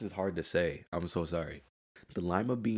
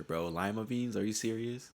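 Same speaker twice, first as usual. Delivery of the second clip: ordinary delivery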